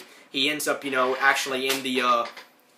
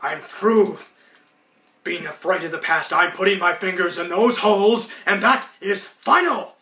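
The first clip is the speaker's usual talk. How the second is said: higher, louder heroic vow, slowing at end; resolute declaration; 5 semitones higher, 4 dB louder than usual. Retold or imitated. Ed summoning his resolve to bowl, higher heroic vow. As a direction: steel yourself and declare it. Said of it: "and he says".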